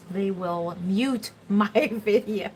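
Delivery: slow, deliberate words broken by a laugh; laughing self-correction. Real, not acted. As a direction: crack up while correcting your own slip. Laughs at own word slip.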